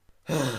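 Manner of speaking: loud exasperated huff; annoyed loud sigh. A narrator's reading. Dash giving in to the clerk, loud annoyed sigh. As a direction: blow out the frustration. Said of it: "Dash groaned".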